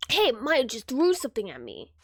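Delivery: ordinary delivery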